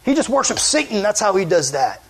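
imitating outraged accusers; acted accusation.

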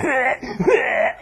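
drawn-out mock retch; imitated dog retching. Storyteller mimics the big dog vomiting all the way back, retching. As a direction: heave like a dog throwing up. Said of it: "beast of a dog just going".

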